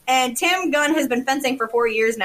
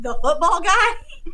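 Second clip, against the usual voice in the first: incredulous; 5 semitones higher, slower than usual. Real, not acted. Incredulous repeat.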